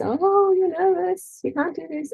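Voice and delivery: high, loud taunting voice of doubt; sing-song jeer from the nervous brain; mocking inner voice. The brain taunts the nervous daughter, high and loud. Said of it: "'Cause the brain says".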